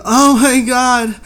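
high, loud mock moaning; hammed-up woman in distress, drawn out and high; moaning and screaming. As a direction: imitate a game woman's panicked screams. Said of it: "she's, like, moaning and screaming".

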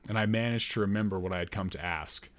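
plain reading voice

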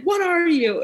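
high, sharp chef's bark; mock Gordon Ramsay demand; Gordon Ramsay yelling. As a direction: bark it like an angry TV chef. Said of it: "he's like".

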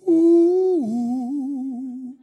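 spooky, long-held mock wail; an ooh like a ghost story sound effect; eerie ooh. Preacher joking about the Twilight Zone, drawn-out eerie ooh.

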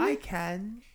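high put-on character voice; changed voice. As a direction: pipe up in a playful fake voice. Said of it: "Can you change your voice?".

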